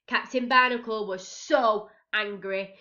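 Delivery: ordinary delivery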